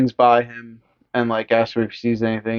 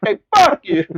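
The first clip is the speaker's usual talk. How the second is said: much higher, squeaky curse; mock Linda insult; 11 semitones higher, 4 dB louder than usual. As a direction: fling it like a petty brat. Voicing Linda.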